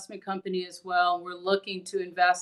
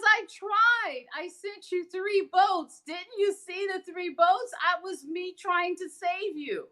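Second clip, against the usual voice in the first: much higher, slow, patient divine voice; God's voice; 10 semitones higher, slower than usual. Retold or imitated. God answering the drowned man in heaven, higher and slow. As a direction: gently explain the three boats as God. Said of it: "He says".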